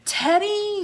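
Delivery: high, loud call for help; yell. A narrator's reading. Jack calls his friend for help, loud and high. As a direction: shout the name across the water. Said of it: "yelled Jack".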